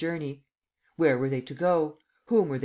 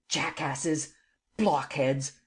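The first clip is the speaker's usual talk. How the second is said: mildly voiced angry insults; a hint of fury in the name-calling; raging shout; much slower than usual. A narrator's reading. Bound Lupin insulting the clumsy guards, mild angry shout. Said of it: "shouted Lupin, shaking with rage".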